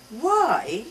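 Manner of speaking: put-on high, loud child's why; insistent child. A narrator's reading. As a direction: demand it like a pestering toddler.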